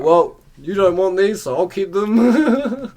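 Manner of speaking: hammed-up smug mate; mocking cheeky voice. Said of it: "He's like".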